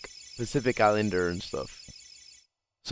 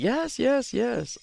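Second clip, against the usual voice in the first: much higher, excited little yeses; eager older lady; 13 semitones higher, much slower than usual. Retold or imitated. Older lady at the airport eagerly agreeing, mock high voice. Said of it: "She's like".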